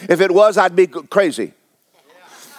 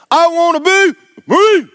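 eager recruit shout; 11 semitones higher, 4 dB louder than usual. Acted. Recruit eager at Marine center.

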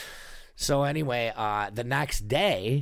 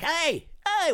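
hey, hey, called out high after him; yelling his name. Retold or imitated. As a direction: shout after a friend running off. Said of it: "we're yelling his name".

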